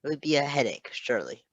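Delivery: plain reading voice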